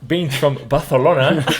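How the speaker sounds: Barcelona with a Spanish lisp, Spanish accent; playful Spanish accent on Barcelona. Shown as a demonstration. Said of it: "in a Spanish accent".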